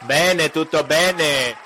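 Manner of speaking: slow, hammed-up Italian; mock Italian. Host trying languages for fun, slow mock Italian. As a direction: stretch it out like a stage Italian.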